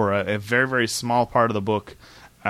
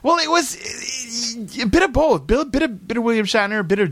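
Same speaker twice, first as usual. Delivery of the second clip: high-pitched impression; 13 semitones higher than usual. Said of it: "Was he trying to do channel William Shatner?".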